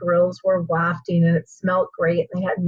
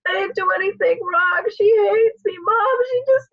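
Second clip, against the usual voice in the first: high, distraught mock crying; acted-out upset wail; imitated tearful daughter; 13 semitones higher than usual. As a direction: plead tearfully to mom, pitched way up. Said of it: "crocodile tears streaming down her face".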